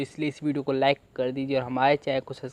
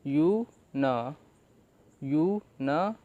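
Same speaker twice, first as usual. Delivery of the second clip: slow syllables; much slower than usual. Shown as a demonstration. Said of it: "इसे हम न पढ़ रहे हैं".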